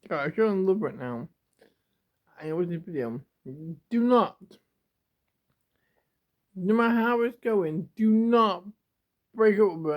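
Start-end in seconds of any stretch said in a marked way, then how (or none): 3.9-9.9 raised, louder pleading warning; emphatic loud plea. Real, not acted. Vlogger warning a viewer in love not to break up, loud and emphatic. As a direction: plead hard with them, voice up and pushed.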